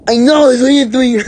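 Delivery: high, loud, fast squawk; put-on bully voice. A narrator's reading. Bertha answers Poopsie mid attack, fast high squawk. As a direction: rattle it off in a squealing voice.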